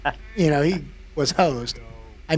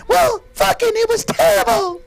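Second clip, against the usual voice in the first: very high, louder grumble; high-pitched sulky whine; 6 dB louder than usual. Retold or imitated. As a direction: whine it in a squeaky voice. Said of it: "Cheese is like".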